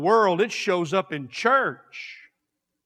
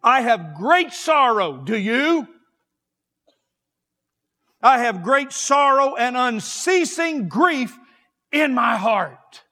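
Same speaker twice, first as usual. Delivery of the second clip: loud, high preacher's cry quoting Paul; fervent preaching; 5 semitones higher, 5 dB louder than usual. Acted. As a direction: cry out Paul's grief to the church. Quoting Paul's sorrow, preaching.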